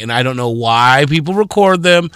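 ordinary delivery

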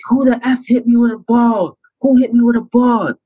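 high-pitched, indignant demand; retold indignant outburst. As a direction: re-enact accusing your teammates. Retold outburst when his Achilles popped.

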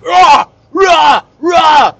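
loud, high grunting roars; mock lifting yells. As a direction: roar with each rep, hammed up. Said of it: "all these guys are yelling".

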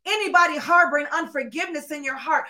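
ordinary delivery

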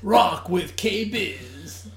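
radio voice. Shown as a demonstration. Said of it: "your voice starts to change".

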